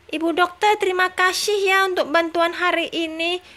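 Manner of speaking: put-on high childlike baby voice; high-pitched little baby talking. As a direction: answer mama like a sweet toddler. Baby Tom Tom thanks doctor.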